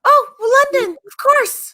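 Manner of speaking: high, playful mock English accent; hammed-up English accent, pitched high; pretend English accent. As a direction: put on a very grand English accent. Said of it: "I just pretend to have one".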